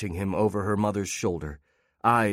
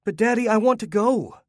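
put-on small child's voice, much higher; little girl; 13 semitones higher, 4 dB louder than usual. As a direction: whine for her father's attention. Young daughter pleads.

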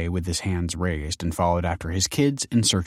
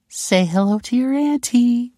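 cooing; 5 dB louder than usual. Acted. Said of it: "she cooed".

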